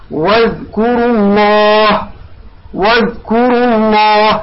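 slow recitation, "الله" with thick, rounded "lloh"; heavy lam. Shown as a demonstration. Teacher recites example after damma, heavy long "الله". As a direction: draw out "واذكروا الله", darkening the lam of "الله". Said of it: "لماذا فخمنا اللام؟".

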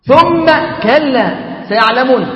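ثم كلا سيعلمون drawn out slowly, stressing ثم; deliberate solemn recitation; slow weighty repeat. Acted. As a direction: let ثم كلا سيعلمون land word by word.

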